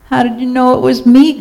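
high-pitched groan of dismay. A narrator's reading. Turkey, caught in cow costume, groans. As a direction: groan it high, rising at the end. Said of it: "groaned Turkey".

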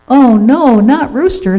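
high, loud cry of dismay; alarmed. A narrator's reading. Turkey hears Rooster may be eaten, alarmed. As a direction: exclaim it in mild alarm.

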